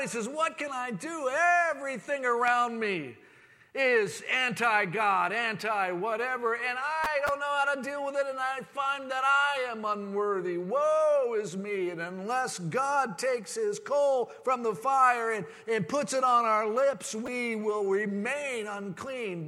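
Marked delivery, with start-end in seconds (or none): 0.3-11.9 anguished prophet crying out. Acted. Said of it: "he was crying out to God". 11.9-19.5 loud, high, impassioned preaching. Acted. Preaching at full force.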